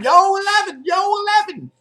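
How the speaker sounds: high, loud casino shout; full-voiced, very high-pitched gambling yell; screamed craps call. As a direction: holler it like a craps table crying out the roll. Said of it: "they scream it out all the time".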